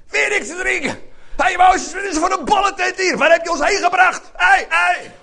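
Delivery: loud, high shouted complaint; hammed-up angry mob shouting; grumpy outrage. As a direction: yell at the leader in acted indignation. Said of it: "ze willen een beetje zagrijnig".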